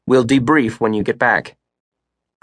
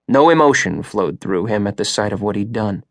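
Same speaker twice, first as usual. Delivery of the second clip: ordinary delivery, nothing marked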